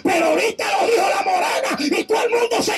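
high shouted rebuke; fervent preaching. Acted. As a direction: raise the roof with the correction.

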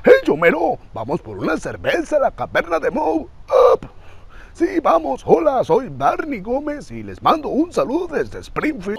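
high, wildly swooping barfly; cracking, lurching cartoon drunk voice; Barney Gómez. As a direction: lurch high like a tavern regular. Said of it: "seguido de la voz de Barney Gómez".

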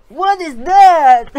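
slow loud stunned question; slow, loud disbelief at the heat; shocked. Real, not acted. Shocked by the spice.

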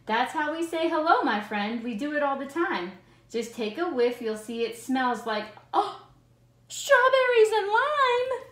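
lightly voiced cheery monster, ending high and loud; a playful monster bragging. Monster's line read aloud.

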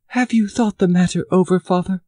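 put-on a girl anxiously asking her father, rising; worried daughter. A narrator's reading.

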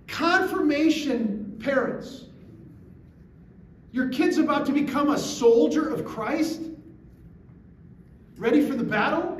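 loud, high, emphatic challenge; indignant disbelief. Real, not acted. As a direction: hammer it out, appalled. Preacher indignant at silent parents.

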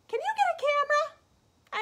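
very high, loud squeaky pig demanding syrup; pig character. Acted. The pig begging the child for maple syrup, squealing high. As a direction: squeal it like an eager piglet.

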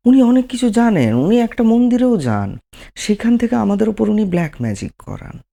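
high-pitched imitation of an anxious believer; acted-out worried grumbling, high; mock worried complainer. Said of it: "দুশ্চিন্তিত থাকেন".

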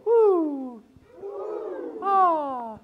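very high, light woo and ah; high vocal warm-up whoop. Shown as a demonstration. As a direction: toss it up high and easy. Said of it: "Everyone go".

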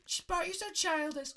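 high falsetto princess voice; Princess Peach. Pretend. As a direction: scold lightly in a squeaky falsetto. Voices Peach scolding Bart.

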